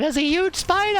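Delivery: high shrieking spider alarm; mock girls' scream. Girls run screaming to the barbecue, high mock shriek. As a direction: squeal it like the panicked girls. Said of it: "the girls came screaming".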